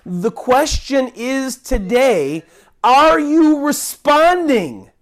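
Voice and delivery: slow, weighty appeal rising on are you responding; deliberate pleading question; slow urgent question. Acted. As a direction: press the question home, slowly.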